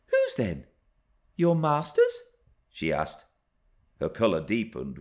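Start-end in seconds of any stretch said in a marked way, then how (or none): 0.0-2.2 put-on higher, girlish questioning; inquiring young girl. A narrator's reading. Young Cathy pressing Hareton for an answer, high girlish voice.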